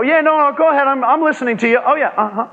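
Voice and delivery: high, quick mock brush-off; distracted listener. Coworker brushing off a colleague, mock distracted.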